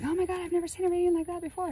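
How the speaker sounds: high, fast gushing disbelief; imitating tarot readers freaking out; amazed tarot people. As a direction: mimic stunned tarot readers gasping. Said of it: "They're like".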